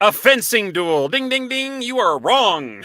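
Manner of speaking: high, loud, slow game show ding; game show host. Pretend. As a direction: announce it like a quiz host. Joke answer, game-show buzz.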